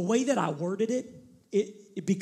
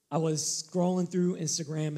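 usual speaking voice

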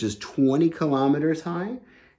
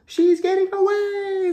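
very high, loud squeaky cry of little helpers; tiny creatures crying out; 5 dB louder than usual. Acted. Little friends cry out as she escapes, high squeaky shout. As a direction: shriek it like tiny friends watching her slip away.

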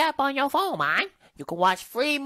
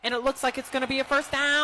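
sports commentator; 2 semitones higher, 3 dB quieter than usual. Acted. Play-by-play on TV.

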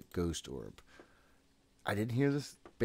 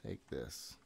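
muttered; 6 semitones lower, 9 dB quieter than usual. Real, not acted. Player placing gear, muttering quietly to himself. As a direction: lower and much softer, under the breath. Repeats under breath.

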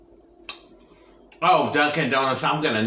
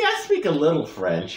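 ordinary talk, nothing marked

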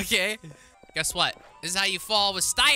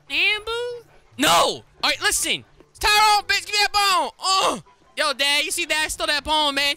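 pitched-up, cocky street-dog voice; 6 semitones higher, 5 dB louder than usual. Pretend. Tyrone the dog sasses his owner in a higher, cocky voice. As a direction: talk back like a cheeky streetwise dog.

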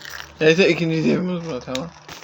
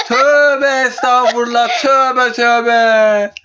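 laughing; 7 semitones higher, 5 dB louder, much slower than usual. Real, not acted. Laughing repentance after joke.

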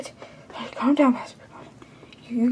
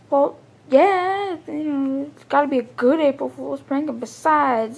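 usual speaking voice

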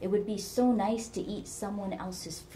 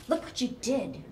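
higher, hissed accusation; 7 semitones higher than usual. A narrator's reading. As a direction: hiss the blame at him. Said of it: "Geraldine hissed".

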